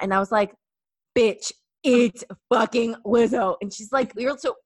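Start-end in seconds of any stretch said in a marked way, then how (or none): 1.2-3.6 louder, slower, emphatic insistence; mock exasperated insistence; insistent. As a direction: hammer it home to a doubting friend. Insisting after friend denies it.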